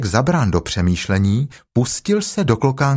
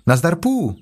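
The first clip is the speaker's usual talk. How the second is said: put-on lightly raised boyish voice; higher, boy-like exclamation; Christopher Robin; 7 semitones higher than usual. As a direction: call out on spotting a friend. Christopher Robin greets Pooh.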